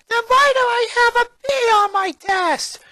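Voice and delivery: very high, loud accusing demand; Lola's furious high-pitched voice; angry little girl. A narrator's reading. Lola storming, upset.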